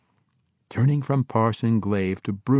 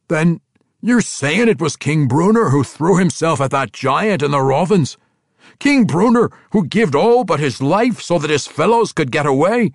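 put-on an old dwarf pressing a point, high and loud; questioning dwarf; 8 semitones higher, 7 dB louder than usual. Ragged Dane challenging Bruner, pressing dwarf voice. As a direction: demand the truth in a dwarf brogue.

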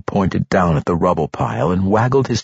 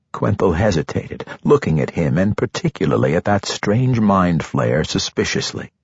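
ordinary delivery, nothing marked

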